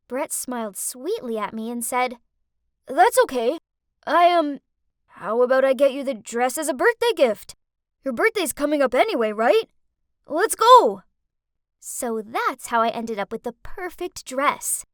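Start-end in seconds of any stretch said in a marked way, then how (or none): 2.9-11.0 much louder, eager and rushing; eager offer. Acted. Dorky boy eagerly offering to buy her the dress, excited. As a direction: blurt it out, thrilled to help.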